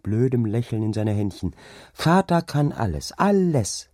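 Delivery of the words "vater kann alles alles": put-on high, gleeful little-girl voice; delighted child. A narrator's reading. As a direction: crow proudly about father. Child claps, proud of father. (begeisterte, hohe Kinderstimme)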